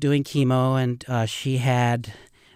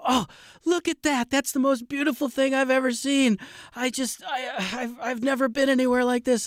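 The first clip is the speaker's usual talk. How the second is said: much higher, delighted gushing in a put-on voice; imitating awestruck old man; 13 semitones higher than usual. Guide imitating elderly rafter marveling at the canyon, awestruck. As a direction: play an elderly rafter overcome with wonder. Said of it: "and he'd go".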